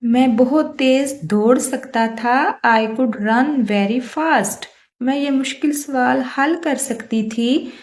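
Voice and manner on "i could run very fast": slow, word-by-word example sentence; slow dictation. Shown as a demonstration. Teacher reading an example to Hindi-speaking students, slow and deliberate. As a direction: read it out slowly for learners to copy. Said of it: "teacher reads English example".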